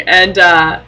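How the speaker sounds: ordinary delivery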